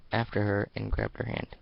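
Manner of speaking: usual speaking voice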